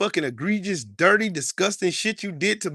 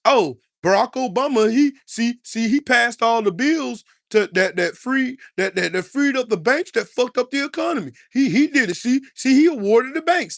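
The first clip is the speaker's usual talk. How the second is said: mock the blamers with a raised, pushy voice; mock Obama blamer; 4 semitones higher than usual. Ranting at viewers, mocking those who blame Obama, hammed-up.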